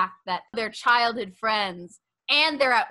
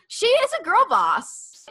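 excited; 11 semitones higher, 5 dB louder than usual. Acted. Hyping up Peggy.

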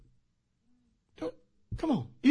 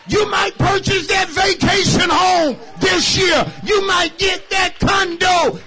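loud, high, shouting preacher's climax; full force preaching; 11 semitones higher, 12 dB louder than usual. Acted. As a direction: whip the congregation up with full voice.

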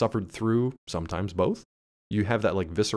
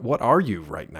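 ordinary delivery

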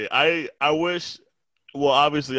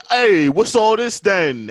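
louder, higher, slow English copper voice; mock British bobby; 6 semitones higher, 6 dB louder, slower than usual. Imagined UK local challenging him in a mock English accent. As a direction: ask it like a nosy London policeman. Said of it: "someone would be like".

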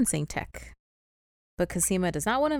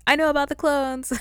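proud, louder, higher; smug reveal; 9 semitones higher, 8 dB louder than usual. Retold or imitated. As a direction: announce it like a triumphant secret. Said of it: "and he's like".

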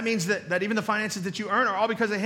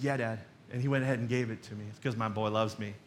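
lower, softer, fond; tender; 9 semitones lower, 6 dB quieter than usual. Retold or imitated. Father recalling his son's gift, soft and tender. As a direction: let it settle gently on the memory.

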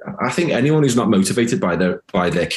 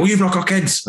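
higher, sneering mock retort; 11 semitones higher than usual. Imitating a defensive parent brushing him off with a sneer. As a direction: fling back a snide dismissal. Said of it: "And then they go".

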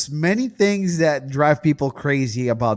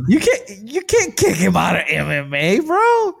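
mock whine; 5 semitones higher than usual. Mocking fighter's plea.

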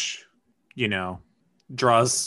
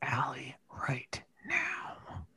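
slow, scared whisper; 3 semitones higher, 8 dB quieter, slower than usual. Pretend. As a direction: whisper it in dread. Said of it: "why are we whispering".

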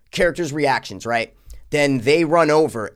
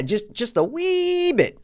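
cutesy voice; 12 semitones higher, slower than usual. Pretend. Cutesy minimizing aside.

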